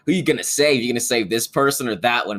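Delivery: mock Riddler taunt. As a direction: tease the hero with a cruel choice. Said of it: "the Riddler's like".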